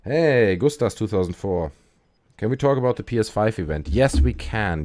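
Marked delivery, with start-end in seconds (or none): 3.9-4.8 excited. Real, not acted. Enthusiastic echo of a question.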